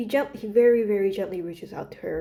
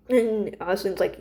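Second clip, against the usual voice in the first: ordinary talk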